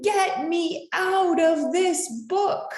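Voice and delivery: high panicked yell, voice shifted; high scream. A narrator's reading. Bookmark on shark page screams to get out. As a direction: shriek to be let out. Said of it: "and I screamed".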